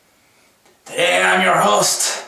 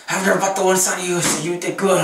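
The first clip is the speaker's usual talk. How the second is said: ordinary talk, nothing marked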